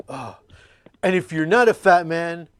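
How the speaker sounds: ordinary talk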